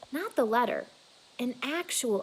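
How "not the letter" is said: ordinary delivery, nothing marked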